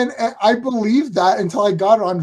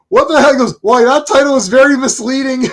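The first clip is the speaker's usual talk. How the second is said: higher, louder, mock-outraged protest; retold indignant complaint; 6 semitones higher, 7 dB louder than usual. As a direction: complain like a cheated moviegoer, played for laughs. Acted grandfather's film complaint.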